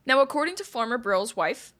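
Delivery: ordinary talk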